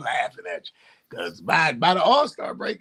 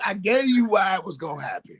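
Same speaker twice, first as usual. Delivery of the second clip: ordinary talk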